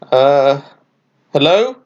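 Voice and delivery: mild nervous greeting; nervously. Acted. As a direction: call out uncertainly to the staring birds. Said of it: "Franklin said nervously".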